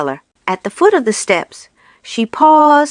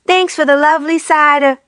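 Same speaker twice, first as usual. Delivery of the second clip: cheeky thanks; 6 semitones higher than usual. A narrator's reading. Taunting farewell to Rat.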